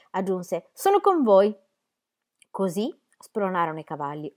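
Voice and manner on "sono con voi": higher, louder, fired-up declaration; a man whose blood is up, all in; hot-headed vow. A narrator's reading. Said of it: "cui va sempre il sangue alla testa". (slancio focoso, più acuto e più forte)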